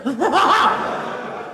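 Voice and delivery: high loud burst of laughter. Real, not acted. Comedian laughing at his diesel joke, loud high burst of laughter. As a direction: crack up at own joke.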